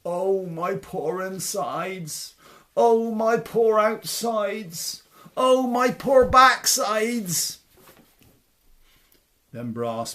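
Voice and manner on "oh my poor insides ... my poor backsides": slow groans building higher and louder; hammed-up escalating lament; moaning giant. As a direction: wail each hurt worse than the last. Beaten giant moans.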